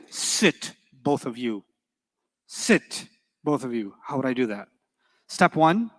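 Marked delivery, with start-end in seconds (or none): 0.1-4.0 spoken command. Shown as a demonstration. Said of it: "But if I say".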